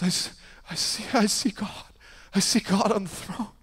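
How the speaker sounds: starts hushed, rising in awe; a touch of awe in a hushed voice; hushed awed voice. Retold or imitated. Man on the floor telling what he sees, hushed awe. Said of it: "he goes".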